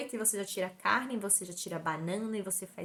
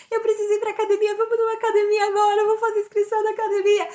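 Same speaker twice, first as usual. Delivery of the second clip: much higher, louder, fast frantic sobbing; desperate wail; 13 semitones higher, 11 dB louder, faster than usual. Retold or imitated. Begging her husband to go to a gym now, frantic wail. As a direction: let the panic spill out, nearly shrieking. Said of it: "Um desespero".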